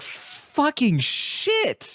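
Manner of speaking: high, very slow, drawn-out mock gasp of shock; reacting to a horror passage, high and slow; stunned disbelief. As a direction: stretch it out in appalled disbelief. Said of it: "I was just like".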